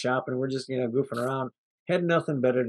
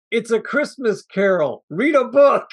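wife's dry put-down, a bit louder; snarky retort; 6 semitones higher, 6 dB louder, slower than usual. Retold or imitated. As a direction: fire back, higher and mocking. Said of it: "she's like".

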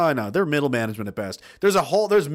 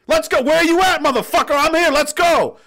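high, loud rallying shout; hyped call-out; 8 dB louder than usual. Retold or imitated. Go-getter summoning people from the parking lot, loud and fired up. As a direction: pump them up and call them over.

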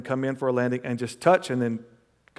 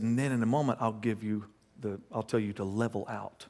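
softer, scheming instructions; hushed instructions; 4 semitones lower, 8 dB quieter than usual. Retold or imitated. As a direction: quietly set up the trick. Plotting prank on sleeper.

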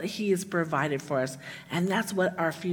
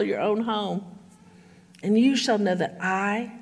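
measured, somewhat louder reading of a Bible passage; solemn scripture reading; 3 semitones higher, 3 dB louder than usual. Acted. Pastor reading Ezekiel to the congregation, solemn and measured. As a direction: read the prophecy with weight, letting key words land. Rereads verse fourteen to congregation.

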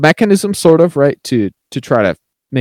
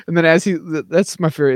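ordinary talk

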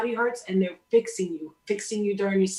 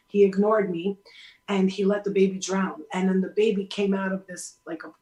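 usual speaking voice

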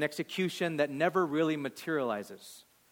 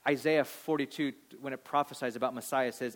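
usual speaking voice

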